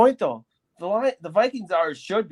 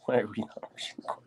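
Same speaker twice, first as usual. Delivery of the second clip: whispering; 5 semitones lower, 10 dB quieter, much faster than usual. Real, not acted. Aside while watching the game.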